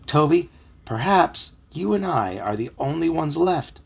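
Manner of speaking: slow, portentous delivery with long pauses; dramatic pronouncement. A narrator's reading. Puppy warns Toby the town is lost, slow and dramatic. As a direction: draw out the grim theory.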